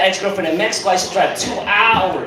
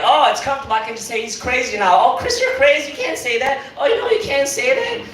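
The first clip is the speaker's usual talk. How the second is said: high, mocking friends voice; teasing friends; 7 semitones higher than usual. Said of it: "everybody started to be like".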